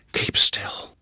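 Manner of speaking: hushed unvoiced whisper. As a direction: whisper in terror. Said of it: "could do was to whisper".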